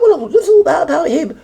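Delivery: high, prissy feigned disbelief; lightly voiced fake surprise; mock naive crowd. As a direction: mimic the crowd pretending ignorance. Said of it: "And everyone went".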